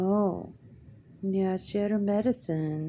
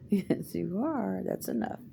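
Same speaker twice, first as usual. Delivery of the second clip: normal talking voice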